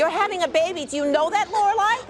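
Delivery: loud, outraged question; loud exasperated reprimand; scolding. Acted. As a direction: demand it loudly, pitch climbing on the name. Mother scolds daughter in labor.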